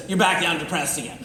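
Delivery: normal talking voice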